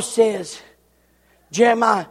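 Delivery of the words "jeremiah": usual speaking voice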